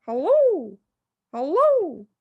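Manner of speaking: high, loud parrot squawk of hello; squawking parrot calling out. Acted. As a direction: call down the chimney like a parrot. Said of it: "the bird called down to her".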